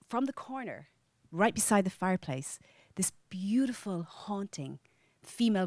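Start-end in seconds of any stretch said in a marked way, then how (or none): none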